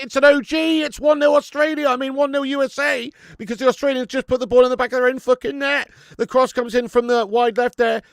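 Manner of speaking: loud, high play-by-play of an own goal; football commentary. Acted. Streamer calling the USA-Australia own goal, excited commentary.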